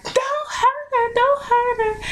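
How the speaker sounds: high, stretched-out pleading wail; imitated crying mom. Mother in the background pleading with police, imitated crying. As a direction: wail it slowly, mimicking a tearful mother. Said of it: "my mom was just crying".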